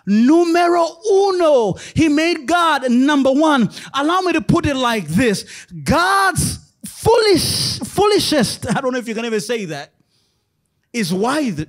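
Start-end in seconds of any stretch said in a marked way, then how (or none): 0.1-1.8 proclaimed. Acted. Preacher proclaims God number one. 5.8-8.7 drawn-out high shout; slow, high, ringing preacher shout; preaching shout. Acted. Preacher exclaims paradox.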